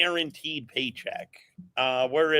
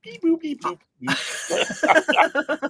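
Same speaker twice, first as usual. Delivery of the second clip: high-pitched little robot beeps; robot toy; 13 semitones higher, much slower than usual. Acted. Co-host teasing about robot toys on the desk, robot voice. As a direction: beep like a tiny toy robot, high and staccato.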